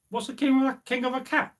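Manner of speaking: high, baffled question; can't make sense of it; puzzled disbelief. Acted. Old gravedigger baffled by the cat king, high and puzzled.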